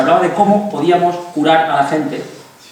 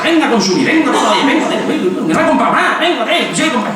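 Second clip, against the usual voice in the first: high, breathless mock frenzy with laughter; frantic shopper in a mock shopping rush; 9 semitones higher than usual. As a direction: play a shopper caught in a buying frenzy.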